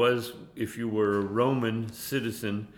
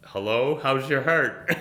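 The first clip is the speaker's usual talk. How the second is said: laughing through it; 3 semitones higher, 6 dB louder than usual. Retold or imitated. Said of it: "the Lord just spoke to me".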